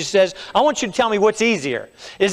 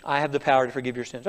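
quiet, gentle pastoral assurance; softly reassuring demonstration; soft mock assurance; 7 semitones lower, 8 dB quieter than usual. Shown as a demonstration. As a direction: say it low and soothing, as in an office. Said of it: "I say".

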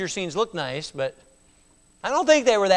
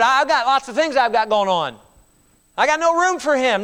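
higher, louder brush-off; dismissive excuse; 6 semitones higher, 5 dB louder than usual. Retold or imitated. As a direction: wave God away, too busy. Imagined person dismissing God.

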